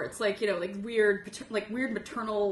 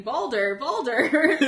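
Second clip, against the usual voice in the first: calling out, rising higher and louder; retold yell, half screamed with a laugh; mock scream; 4 semitones higher, 7 dB louder, much slower than usual. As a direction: heckle from the crowd, louder on the repeat. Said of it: "I'm, like, screaming".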